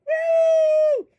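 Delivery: a high cheering woo; high whoop. Acted. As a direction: whoop like a fan in the stands. Cheer after the chant.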